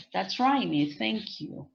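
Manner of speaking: ordinary delivery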